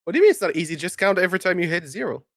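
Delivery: normal talking voice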